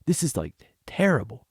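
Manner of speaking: hushed, unvoiced whisper of dismay; whispered aside. Watching a bad promo, he whispers in dismay. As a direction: breathe it out in a horrified whisper. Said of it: "I was like".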